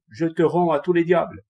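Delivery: 1 grim retort. A narrator's reading. Monk's retort before killing.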